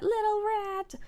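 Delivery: high doting singsong; high-pitched coo. Real, not acted. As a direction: coo it high, as at a tiny pet. Cooing at the rat.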